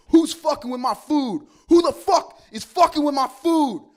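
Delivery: high, furious accusing shout; loud, laughably over-the-top demand; angry confrontation. Retold or imitated. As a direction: confront the whole room at a yell. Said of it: "went up and confronted everybody".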